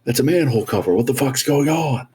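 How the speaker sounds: high, startled disbelief; baffled shout. Retold or imitated. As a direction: react like you saw a UFO. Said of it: "and they're like".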